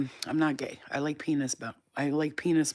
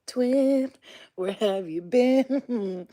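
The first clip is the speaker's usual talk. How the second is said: higher, drawn-out pet call; playful cooing; 7 semitones higher, 5 dB louder, much slower than usual. Real, not acted. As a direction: call to a beloved pet, stretching it out. Calling pet her twin.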